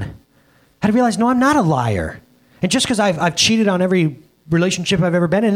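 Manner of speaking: normal talking voice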